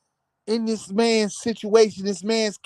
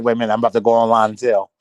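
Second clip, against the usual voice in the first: mock gossip rush; 11 semitones lower, 4 dB louder, much faster than usual. Mocking excited gossip poster.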